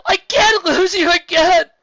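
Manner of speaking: high, loud, slow crying shout. Real, not acted. Spike crying out to Twilight. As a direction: sob it out at full voice.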